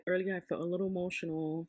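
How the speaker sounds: ordinary talk, nothing marked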